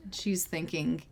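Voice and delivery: ordinary talk, nothing marked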